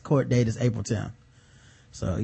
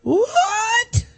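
shocked. Real, not acted. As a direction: react in disbelief. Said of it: "shocked reaction".

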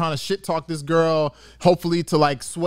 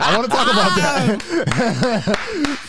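slow, higher, laughing drawl; drawn-out laughing; 7 semitones higher, much slower than usual. Real, not acted. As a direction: stretch it out through laughter. Repeats it slowly, laughing.

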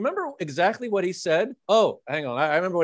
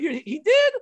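much higher incredulous question; mock surprise; 11 semitones higher, 4 dB louder than usual. John's mock surprise at being included, much higher. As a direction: play it amazed. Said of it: "he was like".

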